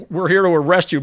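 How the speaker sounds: loud, firm arrest; stern announcement. Retold or imitated. Military police tell Yossarian, loud and stern. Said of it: "They go".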